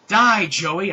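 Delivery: high, very loud battle cry; yelled battle cry. A narrator's reading. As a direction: scream it while firing. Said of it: "I yelled".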